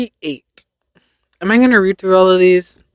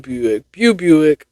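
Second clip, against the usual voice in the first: normal talking voice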